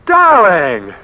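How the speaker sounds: loud, high burst of delight; joyful cry. Acted. Man realizing the woman will marry him, overjoyed outcry. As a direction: cry it out in happy relief.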